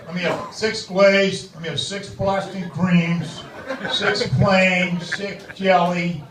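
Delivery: put-on slow, emphatic South Boston accent. South Boston customer reciting his exact donut order, thick local accent. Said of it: "a guy at Dunkin' Donuts in South Boston".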